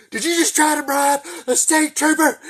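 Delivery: put-on high, loud furious bark at a kid; screaming state trooper; angry trooper yell. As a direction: blow up at the boy like an outraged trooper. Trooper yelling after bribe.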